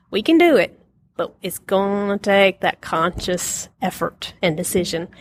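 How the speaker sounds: ordinary delivery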